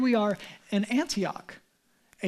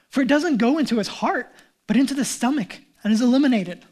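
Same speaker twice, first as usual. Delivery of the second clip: raised reading; 3 semitones higher, 7 dB louder than usual. Acted. Reading Jesus' words, raised.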